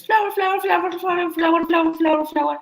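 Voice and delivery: high, rapid repeated chirp, held on one pitch. Retold or imitated. Imitating a bandmate picking a little flower, high singsong chant. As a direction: rattle it off high and loud in imitation. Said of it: "he says".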